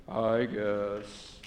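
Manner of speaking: droopy, low killjoy mumble; dejected mock-killjoy drone. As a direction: put on a gloomy killjoy's voice. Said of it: "walk around like this, talk like this".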